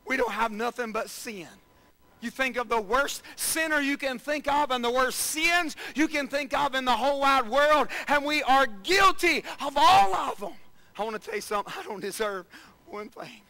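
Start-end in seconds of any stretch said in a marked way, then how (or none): 2.2-10.5 rising, louder preaching build. Acted. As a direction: build the sermon up to a loud peak. Preacher building to full force.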